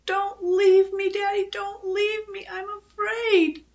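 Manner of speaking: put-on high, drawn-out child's wail; panicked, pleading high cry; terrified shriek. A narrator's reading. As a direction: shriek and cling, very high and slow. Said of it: "threw her arms around her daddy's neck and shrieked".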